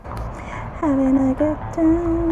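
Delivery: loud, slow ironic chant; sarcastic singsong. Real, not acted. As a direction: belt it out sarcastically. Sarcastic singsong while struggling.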